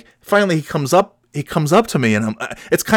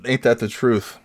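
normal talking voice

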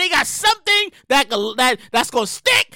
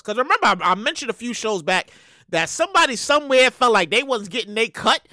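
usual speaking voice

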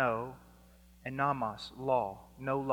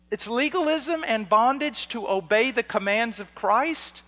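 high, loud disbelieving outburst; incredulous shout; 8 semitones higher, 9 dB louder than usual. Retold or imitated. Preacher retorting to Christians calling obedience legalism, loud incredulous shout. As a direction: shout back in outraged disbelief.

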